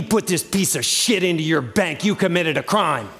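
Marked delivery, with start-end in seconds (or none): none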